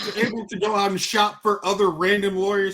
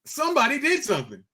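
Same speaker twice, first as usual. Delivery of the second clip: high-pitched, play-acted discovery of a burglary; mock alarmed homeowner; 6 semitones higher than usual. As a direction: act out an earnest, clueless homeowner reporting a theft. Acts out oblivious homeowner missing piano.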